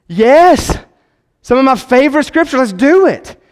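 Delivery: high, loud burst of glee; thrilled reply to a friend's plan; excited yes. Retold or imitated. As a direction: cheer like you just got the best news. Retold excited reaction to John one.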